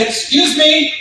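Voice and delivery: high, drawn-out call for attention; retold polite demand. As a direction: act the entitled guest hailing a clerk. Guest calling the front desk clerk.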